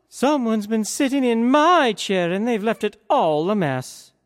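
an indignant mother bear, shocked; high, put-out mama bear. A narrator's reading. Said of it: "And the mama bear".

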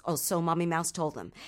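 usual speaking voice

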